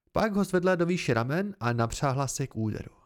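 ordinary delivery, nothing marked

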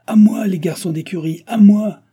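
high, loud summons; shouting for help. A narrator's reading. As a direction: shout for help, building to the end. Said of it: "cria l'hôtelier".

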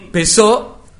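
high, loud parent voice; parent's instruction. Acted. Father telling child the deadline, high and loud. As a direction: give the order like a father.

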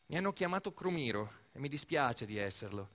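ordinary delivery

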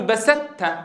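بسدت with the ط fully turned into a plain د, no emphatic trace; slow wrong-example بسدت with no emphasis; wrong full merging. Shown as a demonstration. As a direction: demo the incorrect بسدت, ط lost completely. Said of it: "ولا ننطق هكذا".

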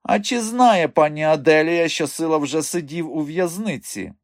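high, needling dwarf voice; on the attack. A narrator's reading. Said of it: "перейшов він у наступ". (наступально, високим голосом)

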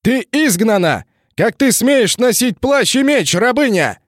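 high, slow, outraged shout; indignant shout. Acted. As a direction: bellow the banishment in outrage. Knight banishes her, shouting.

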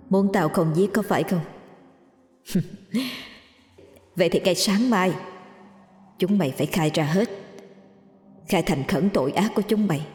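the spirit gloating quietly over terrified killers; vengeful ghost with eerie laughs. Acted.

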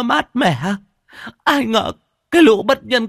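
high-pitched, loud wailing over the parents' grave; keen and wail like a grieving daughter-in-law; wailing lament. A narrator's reading. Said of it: "vỗ bồm bộp vào mộ mà gào khóc". (thím Cải gào khóc thảm thiết bên mộ bố mẹ)

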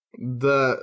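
trembling voice, from anger and fear; shaky voice. Acted. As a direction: let the voice shake on the word. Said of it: "Мой голос задрожал".

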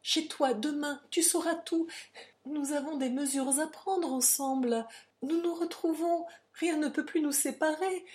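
high, urgent rush of words; frantic, voluble pleading; heedless volubility. A narrator's reading. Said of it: "avec une volubilité d'inconsciente".

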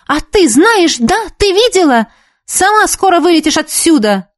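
high-pitched indignant retort; flaring up. A narrator's reading. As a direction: hit back hotly at an accuser. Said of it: "взвилась Нефёдова". (возмущённо, взвившись)

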